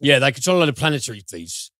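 put-on quick, jokey Cockney accent. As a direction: rattle it off in Cockney. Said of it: "as in thief in a Cockney accent".

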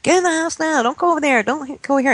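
high-pitched imitation of nagging parents; mock parental yelling. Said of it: "the parents would yell at him".

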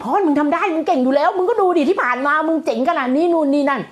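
pumped-up self pep talk. Retold or imitated.

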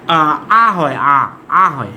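আ as a low, open vowel sound. Shown as a demonstration. Teacher showing pupils how U can sound, open low "aa". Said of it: "ইউ এর উচ্চারণটা যদি".

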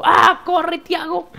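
high, loud panicked yell; panicked scream. Retold or imitated. Recalling fleeing the monster, panicked shriek. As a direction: shriek at yourself to run. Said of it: "Y yo como".

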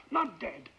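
ordinary talk

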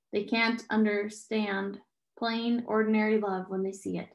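ordinary delivery

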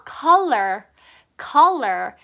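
"Color" with the tongue lightly bitten then flicked back; bite then flip the tongue. Shown as a demonstration. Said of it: "살짝 물었다가 뒤로 뿅".

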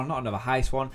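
usual speaking voice

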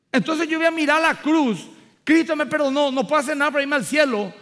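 loud, high, fervent preaching; full-force preaching. Acted. Preacher testifying to the congregation, loud and fervent. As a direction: proclaim it to the church with rising fire.

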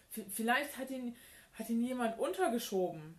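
high, loud, flustered stammer; tripping over words. A narrator's reading. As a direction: stumble and restart in agitation. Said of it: "verhaspelte sich fast vor Aufregung". (aufgeregt verhaspelt)

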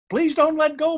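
high, loud plea over rushing wind; panicked shout while dangling in the air; frightened shout. A narrator's reading. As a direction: yell so you're heard above the wind. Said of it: "Miles shouts above the wind".

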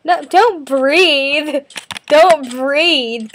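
slow, loud drawn-out plea; pleading. Real, not acted. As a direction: stretch each word, begging. Pleading with stepsister.

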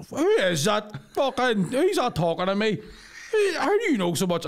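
high, drawn-out imitation of dad's disbelief; bewildered put-on dad voice; baffled dad. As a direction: demand to know who's talking, in mock alarm. Retold dad baffled by voice next door.

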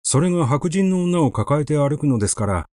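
ordinary delivery, nothing marked

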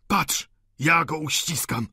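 drawn-out burst of devotion; wild fervour. A narrator's reading. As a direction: exclaim it with mad zeal. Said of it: "z szalonym jakimś zapałem".

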